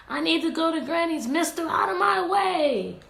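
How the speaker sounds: Red tells the wolf to step aside, mildly loud; frustrated Red. Acted. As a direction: push past the wolf, impatient. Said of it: "I need one more".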